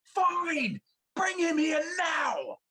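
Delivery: high, loud angry shout; shouted order. Acted. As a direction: yell it as a furious command. DM NPC yelling demand.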